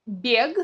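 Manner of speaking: бег with a voiced "g" at the end; voiced final G. Shown as a demonstration. Teacher showing spelling, бег with voiced final g. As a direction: sound the written г in бег. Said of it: "от слова".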